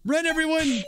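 high-pitched acted shout; dramatic game-character alarm; urgent warning call. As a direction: call out for everyone to flee. Voices urgent warning in game fight.

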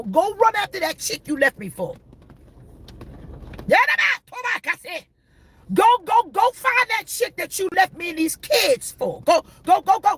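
scorned partner. Acted. Preacher playing the left partner telling him to go, shouting. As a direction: shoo him off like a wronged wife.

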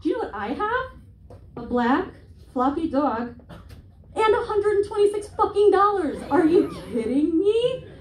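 high, loud mock-amazed sarcasm; sarcastic comeback. Comic's imagined comeback to the dog owner, high sarcastic outrage. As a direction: fake amazement, building to outrage. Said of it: "what I should have said was".

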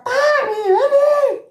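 high-pitched imitated yell, drawn out; grito imitado. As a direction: call Tami over in a retold shout. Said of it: "y me grita". (grito agudo llamando a alguien)

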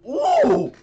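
loud, very high "Ooh!"; wincing high-pitched ooh. Real, not acted. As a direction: squeal an "Ooh!" at the replay. Reaction to play.

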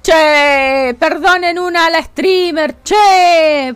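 grito agudo y fuerte hacia el chat, lento; grito en broma. Real, not acted. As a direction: reclamale al chat a los gritos, medio en broma. (grito burlón al chat, agudo y lento)

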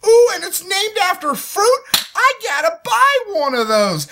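high, loud, squealing fake delight; hammed-up consumer excitement; mock gushing buyer. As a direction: gush like a gullible shopper. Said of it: "It's like".

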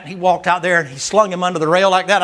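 ordinary delivery

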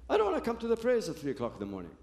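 fast, high, complaining rush; whiny protest. Retold or imitated. Roommate protests being woken for dawn prayers, whiny and fast. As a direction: whine it out, pitch jumping up.

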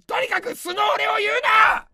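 mock-angry shout. Comedian yelling at a fan to stop describing his real self, mock-angry shout. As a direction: bellow it out in comic outrage. Yells at commenter.

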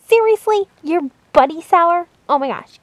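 ordinary delivery

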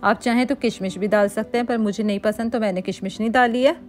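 ordinary talk, nothing marked

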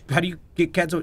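ordinary delivery

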